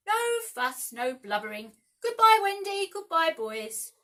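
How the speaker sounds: loud, high brave goodbye, slowing at the end; brisk cry. A narrator's reading. Peter bidding Wendy and the boys a loud brave goodbye. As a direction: hold back tears with loud bravado. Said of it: "cried Peter".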